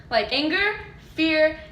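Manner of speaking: ordinary delivery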